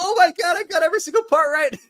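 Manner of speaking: very high, loud excited squeal; thrilled amazement. Real, not acted. Co-host finding every prediction was right, squealing with excitement. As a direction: burst out in delighted disbelief. All his predictions came true.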